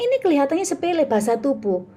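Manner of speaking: usual speaking voice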